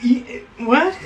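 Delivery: baffled high "what"; confused. Retold or imitated. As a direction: sound completely thrown. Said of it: "And I'm like".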